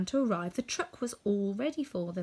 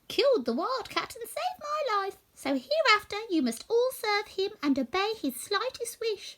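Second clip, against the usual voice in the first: much higher, squeaky orders; commanding mouse queen; 12 semitones higher than usual. A narrator's reading. Mouse queen ordering her mice, high squeak. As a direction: decree it to your subjects.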